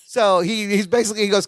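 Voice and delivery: ordinary talk, nothing marked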